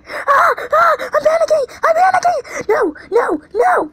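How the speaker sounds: high, loud panicked screaming; scream of terror. Real, not acted. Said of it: "That was a scream of scaredness".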